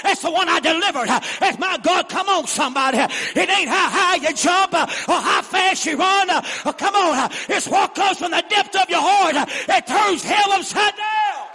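high, loud, driving preacher delivery with gasped line ends; full-force preaching. Real, not acted. Preacher urging the congregation to shout along, full-force preaching. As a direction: preach it hard to the crowd.

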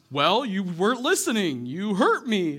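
talking donkey. Retold or imitated. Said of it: "the donkey turns to him and goes".

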